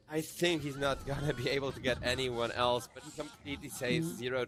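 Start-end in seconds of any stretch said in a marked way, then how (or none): none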